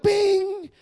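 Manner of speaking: high, held "bing"; one sustained high-pitched ding; imitated piano note. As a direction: imitate a single ringing key note. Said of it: "one key note".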